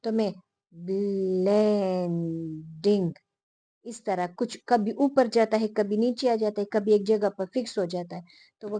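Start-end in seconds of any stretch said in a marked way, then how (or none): 0.7-3.1 blending drawn out very slowly, pitch gliding down low; intonation demo. Shown as a demonstration. Teacher showing students intonation, slow gliding tune. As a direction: stretch blending to show rising and falling tone. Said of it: "फर्ज करिए blending है, तो मैं".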